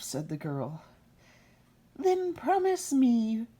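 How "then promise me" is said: high, slow, sly imp voice; squeaky bargaining imp; little man's voice. Acted. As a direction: lure her into the bargain like a goblin.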